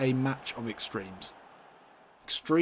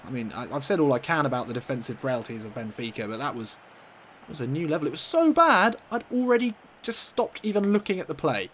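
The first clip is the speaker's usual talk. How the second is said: ordinary delivery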